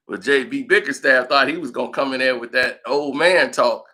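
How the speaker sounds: usual speaking voice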